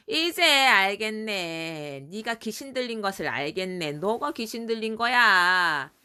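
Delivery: drawn-out mock realization; acted scoffing. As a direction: stretch it out, sneering at him. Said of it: "유대인들이 예수님께 말했어".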